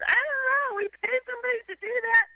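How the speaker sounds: very high, entitled whine; imitated pampered wife. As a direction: whine it like a spoiled rich wife. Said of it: "entitled wife again".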